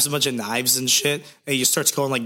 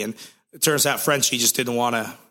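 usual speaking voice

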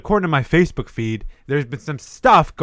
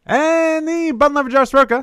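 high ring-announcer intro; grand entrance announcement; 12 semitones higher than usual. Acted. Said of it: "grand host introductions".

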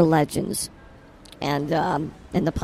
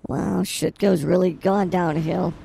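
ordinary talk, nothing marked

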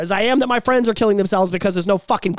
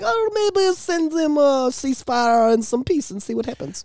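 mock Putin; 5 semitones higher than usual. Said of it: "Putin's like".

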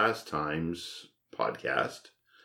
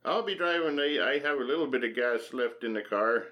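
leprechaun; 7 semitones higher than usual. Pretend.

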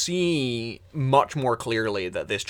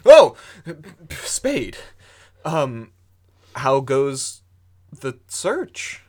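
high, loud jump of surprise; startled greeting; 5 semitones higher, 10 dB louder, slower than usual. Acted. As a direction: caught off guard. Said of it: "Sam kinda goes".

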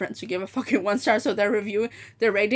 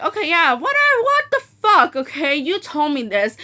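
higher, louder acted outburst; indignant retort; 5 semitones higher, 4 dB louder than usual. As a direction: re-enact an exasperated phone argument. Retelling her angry refund argument.

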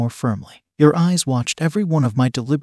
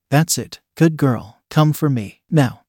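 plain reading voice

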